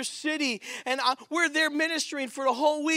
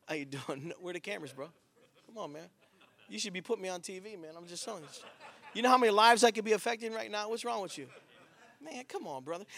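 playful aside; 5 semitones lower, 9 dB quieter, faster than usual. Real, not acted. Joking aside to a man.

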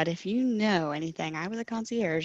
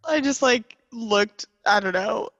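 ordinary delivery

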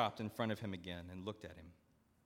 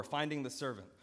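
ordinary talk, nothing marked

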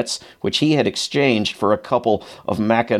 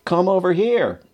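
lightly voiced sergeant, much higher, falling at the end; mild mock holler across a distance; sergeant calling back; 9 semitones higher than usual. A narrator's reading. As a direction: echo the request as a high, drawn call. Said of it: "one of our sergeants repeated the request".

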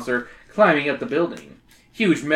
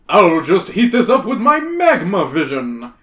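grand announcement, a bit louder; announcing; 3 semitones higher, 6 dB louder than usual. Acted. Hero announcing his magma vision plan. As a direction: proclaim it like a hero. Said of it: "you announce".

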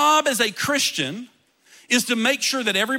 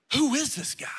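whisper; 4 dB quieter than usual. Retold or imitated. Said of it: "People began to hit each other".

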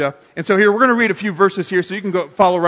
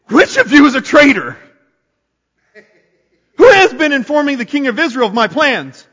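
demanding king; 5 semitones higher than usual. A narrator's reading. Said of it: "and demanded".

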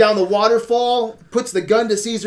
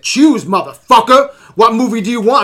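mock gunman demand; 4 semitones higher, 7 dB louder than usual. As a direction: bark the demand like a hammed-up gunman. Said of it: "I'm like".

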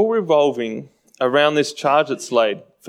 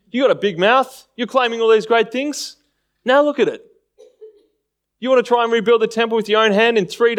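higher, scornful jeering at a man on the cross; passerby taunting the crucified Jesus, mocking and higher; 5 semitones higher than usual. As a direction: sneer up at him, daring him to come down. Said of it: "to have a mocking tone towards the Lord ... in imitating this".

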